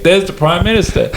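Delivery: jokey put-on Aussie call-out; imitated Australian accent. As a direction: quote a cartoon character in an Australian accent. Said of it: "and they were like".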